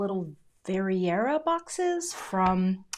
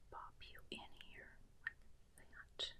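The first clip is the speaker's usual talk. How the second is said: very soft, voiceless whisper; real involuntary whisper; 22 dB quieter than usual. Real, not acted. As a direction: whisper to yourself, lost in concentration. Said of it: "Sorry for the whispering".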